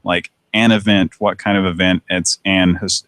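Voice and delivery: ordinary delivery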